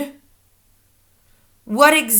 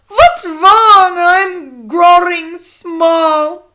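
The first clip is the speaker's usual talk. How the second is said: loud, high, very drawn-out wail; slow theatrical cry of alarm; 9 semitones higher, 10 dB louder, much slower than usual. A narrator's reading. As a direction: wail it as a dismayed boy.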